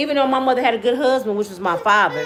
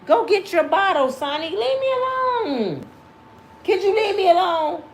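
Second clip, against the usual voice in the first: high playful voice; 8 semitones higher than usual. Real, not acted. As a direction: coo it up high at the little one. Talking to the baby grandson.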